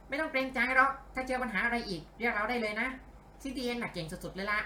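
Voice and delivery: high, chirpy helper voice; eager sidekick. Acted. (เสียงผู้ช่วยตัวน้อย สูงและกระตือรือร้น)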